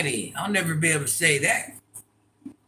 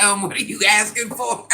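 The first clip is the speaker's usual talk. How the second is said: higher, louder mock puzzlement; imagined baffled reply; 5 semitones higher, 5 dB louder than usual. Imagined order gone wrong, mock puzzled server. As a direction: ask it puzzled, on the edge of laughter. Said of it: "I'd be like".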